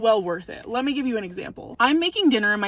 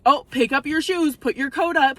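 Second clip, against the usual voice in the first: bossy order; 5 semitones higher, 4 dB louder than usual. Shown as a demonstration. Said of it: "Instead of saying".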